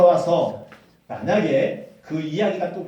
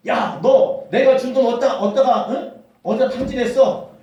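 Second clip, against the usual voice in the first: louder, higher accusing rant; an angry father interrogating his returned son; scolding father; 5 semitones higher, 7 dB louder than usual. Acted. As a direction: demand answers like a furious father. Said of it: "이렇게 따지는 것이 아니라".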